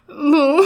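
high, loud boo-hoo; acted loud wail. A narrator's reading. Zygzak left alone at preschool, acted wailing. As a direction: burst out in a storybook cry. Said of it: "rozległ się głośny płacz i krzyk".

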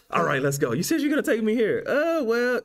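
mock excited child; excited kid voice. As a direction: play the impatient kid pulling dad along. Acting out kid and dad exchange.

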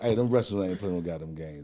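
normal talking voice

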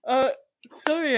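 talking through a yawn; words stretched by a yawn. Real, not acted. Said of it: "I'm yawning all of a sudden".

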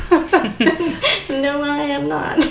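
high, loud, laughing retort. Real, not acted. Mother recalling her answer to her son, laughing. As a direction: break up laughing while quoting your own reply.

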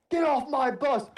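barked high, loud order; shouting. Retold or imitated. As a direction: yell it like a drill sergeant. Drill sergeant yells at recruits.

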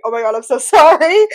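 laughing through a mock sorry; gently mock the pity, laughing; mock sympathy, laughing. Friend mocking others' pity, laughing.

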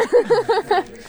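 shrill burst of laughter; high laugh. Real, not acted. Laughing after singing bit.

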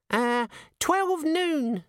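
squeaky imp announcing; giggly sneering imp. Acted. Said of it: "said the imp".

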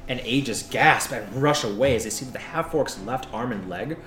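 ordinary delivery

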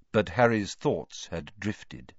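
usual speaking voice

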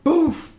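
loud high "Boof!" hit sound; mock impact noise. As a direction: punch out the sound of the shove. Imitating shoulder hit sound.

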